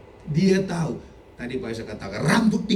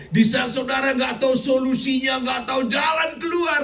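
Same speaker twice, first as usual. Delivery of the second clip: preaching shout; 7 semitones higher than usual. Acted. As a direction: call it out with fervour. Preaching climax.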